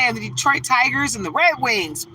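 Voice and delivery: ordinary delivery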